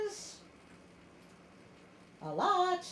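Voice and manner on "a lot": wry emphasis. Real, not acted. Mock complaint about long text.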